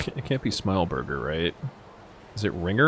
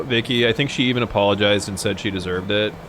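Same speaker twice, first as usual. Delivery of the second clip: normal talking voice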